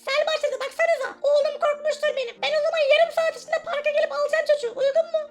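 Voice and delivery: put-on the kid's mother phoning the babysitter, high and quick; mother on the phone. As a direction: play the kid's mother calling in. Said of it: "annesi arıyor çocuğun".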